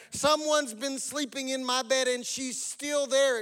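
high little-bear exclamation, slowing down; exclaiming baby bear. A narrator's reading. Baby bear spots Goldilocks in bed, exclaiming. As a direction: pitch up and draw out the discovery. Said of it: "exclaimed the baby bear".

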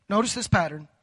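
ordinary talk, nothing marked

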